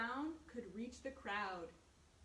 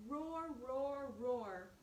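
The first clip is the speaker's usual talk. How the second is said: bear roar; 3 dB louder, much slower than usual. Acted. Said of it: "went the noisy bear".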